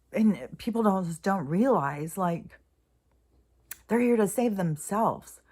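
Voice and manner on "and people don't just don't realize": ordinary talk, nothing marked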